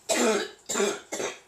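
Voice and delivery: loud, hacking cough on tea; choking cough. Real, not acted. Choking on tea mid-video, a harsh cough. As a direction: cough hard as if tea went down wrong. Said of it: "Choking on tea".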